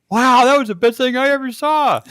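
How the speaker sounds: mock amazement. Retelling the skateboard kid's reaction, mock amazed. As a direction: play a kid thrilled by what happened.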